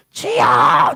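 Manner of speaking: loud breathy shout of Lord; shouted prayer. Shown as a demonstration. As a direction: cry out the prayer in one burst. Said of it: "이렇게 기도하시죠". (크게 외치는 기도)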